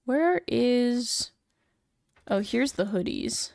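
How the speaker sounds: ordinary talk, nothing marked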